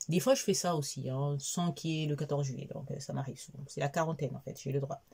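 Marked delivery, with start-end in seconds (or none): none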